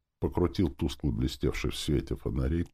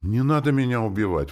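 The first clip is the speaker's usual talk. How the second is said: higher, louder plea; pleading exclamation; 7 semitones higher, 5 dB louder than usual. A narrator's reading. Soldier begging sorcerer for life, exclaiming. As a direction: exclaim it in mild alarm. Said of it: "воскликнул парень".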